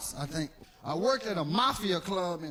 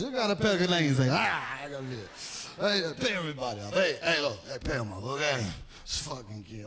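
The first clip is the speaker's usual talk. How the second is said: gruff mobster boss; 7 semitones lower than usual. Acted.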